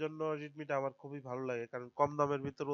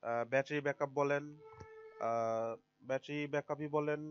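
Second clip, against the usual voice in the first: ordinary talk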